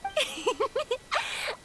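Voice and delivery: a quick cheerful giggle; bright giggle. Acted. Yoimiya giggles brightly before leaving the smiths. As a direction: laugh as you wrap up the visit.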